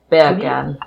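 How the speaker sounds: BEER CAN said like "bacon" in Jamaican; put-on Jamaican, "beer can" squeezed toward "bacon"; Jamaican accent. Shown as a demonstration. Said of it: "in a Jamaican accent".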